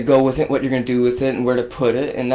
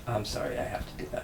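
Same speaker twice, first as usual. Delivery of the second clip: unvoiced hushed aside; whispering; 4 semitones lower, 17 dB quieter, much faster than usual. Real, not acted. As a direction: drop to a whisper. Hushed aside while adjusting the amp.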